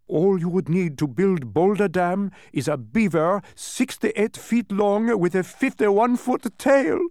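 high, loud, slow proclamation; emphatic, excitable character voice; excited dodecahedron. A narrator's reading. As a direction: build to an astonishing conclusion. Dodecahedron's climax of the example.

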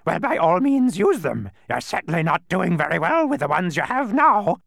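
high, loud, mocking squawk; happy bird. A narrator's reading. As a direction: crow gleefully in a bird screech. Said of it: "cried the bird happily".

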